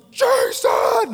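full-force high yell; dad's booming shout. Retold or imitated. Dad losing patience with daydreaming son, booming shout. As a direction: yell to break through the daydream. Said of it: "he would literally get from the".

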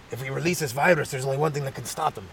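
a hint of a scheming scientist; plotting scientist. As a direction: confide a secret lab plan. Acted scientist plotting in lab.

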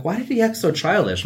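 incredulous question. Retold or imitated. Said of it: "I'm like".

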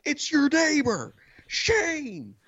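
high, drawn-out mock yell; calling out. Neighbor explains himself to wife upstairs, calling out. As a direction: holler each part as if to another floor. Retold yelling up the stairs.

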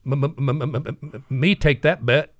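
Pete stammers out his answer to the bet; stammering Pete. Retold or imitated. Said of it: "he had a speech impediment".

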